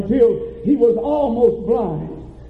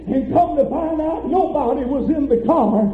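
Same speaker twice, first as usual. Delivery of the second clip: ordinary delivery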